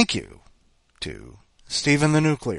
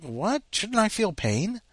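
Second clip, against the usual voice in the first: higher, slower puzzled question; puzzled surprise; 11 semitones higher, slower than usual. Retold or imitated. Wondering why his loose tooth doesn't hurt, puzzled. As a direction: ask it baffled. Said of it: "And I'm like".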